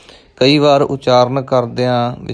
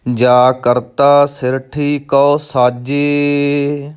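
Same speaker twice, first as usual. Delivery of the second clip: slow, measured recitation with drawn-out syllables; scripture recitation; 2 semitones higher, much slower than usual. Shown as a demonstration. Teacher models a scripture line for learners, slow recitation. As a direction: recite each word slowly and evenly.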